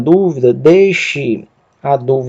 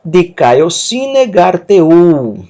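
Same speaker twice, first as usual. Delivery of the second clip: each syllable drawn out very slowly; slow Greek demo; 3 semitones higher, 4 dB louder, much slower than usual. Shown as a demonstration. Teacher models Greek for students, very slow. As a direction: spell the word out slowly for learners. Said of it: "Vou ler o texto".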